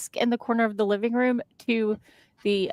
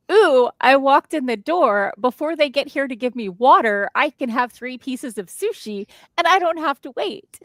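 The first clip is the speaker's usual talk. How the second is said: hammed-up delight, higher and louder; excited diner; 4 semitones higher, 4 dB louder than usual. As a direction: gush like an eager customer. Countering with an eager diner's version.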